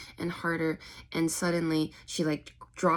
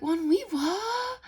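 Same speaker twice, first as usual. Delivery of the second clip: high-pitched squeak; slower than usual. Retold or imitated. Plang caught off guard, her voice going high-pitched and slow. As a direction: voice jumping much higher, drawn out. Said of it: "Her voice is going all high-pitched".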